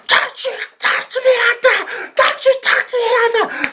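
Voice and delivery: extremely high mock screech of outrage; shrieked warning. Auntie being nudged off in a scuffle, shrieked warning. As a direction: screech the warning, pitch through the roof. Retold grappling, shouting back.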